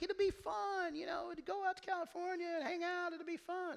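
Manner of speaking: high, loud coaxing friend voice; mock cheerful persuading friend. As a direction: sell it like a pushy buddy.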